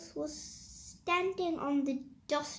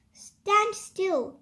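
loud; 5 semitones higher, 7 dB louder than usual. A narrator's reading. Noah chasing quick kangaroo.

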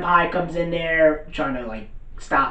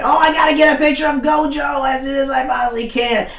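high-pitched imitated fangirl squeal; frantic fangirl; 11 semitones higher, 4 dB louder than usual. Assistant runs to get Gojo's picture, frantic excitement. Acting out assistant running after Gojo.

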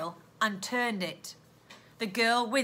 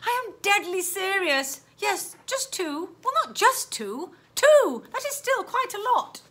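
put-on high, earnest girl's voice; high-voiced girl protesting; pleading girl; 9 semitones higher than usual. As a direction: insist you are serious, pitched high. Stella insists she is serious.

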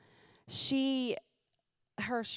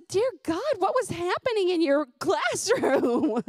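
high, loud, horrified exclamation; shocked outburst; 10 semitones higher, 7 dB louder than usual. Retold or imitated. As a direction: gasp it out in disbelief. Mother's shocked reply to child.

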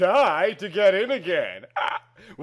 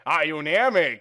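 ordinary talk

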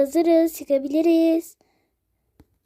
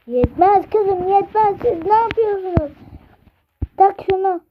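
higher, louder bossy parent; mock shouting parent; 5 semitones higher, 4 dB louder than usual. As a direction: push the kids loudly. Ebeveyn bağırarak zorluyor. (anne babanın çocuklara bağırması)

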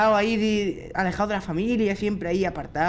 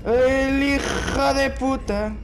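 slow, high, stretched words; drawn-out curse; 5 semitones higher, much slower than usual. Real, not acted. Player cursing the monster, slowly drawn out. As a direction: drag every word of the curse out.

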